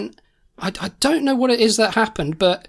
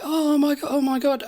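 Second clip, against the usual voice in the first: a retold shriek of alarm, softer than a real one; mock screaming; 7 semitones higher, 3 dB quieter than usual. Naked lollipop lady panics in the street, mock shriek. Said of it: "she's there screaming".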